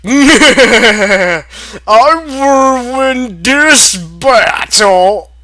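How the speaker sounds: hammed-up villain shout; villain boast. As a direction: proclaim victory like a cartoon villain. Villain boast after laughing.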